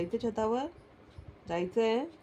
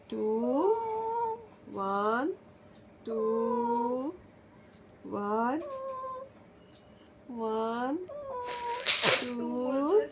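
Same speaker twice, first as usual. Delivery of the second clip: drawn-out count; 3 semitones higher, much slower than usual. Real, not acted. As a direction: drag out three like the end of a countdown. Countdown game with pet. (Three खूप लांबवून म्हणा)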